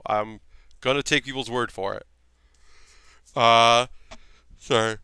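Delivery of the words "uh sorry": through a yawn. Real, not acted. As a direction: speak mid-yawn. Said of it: "Yawn in there".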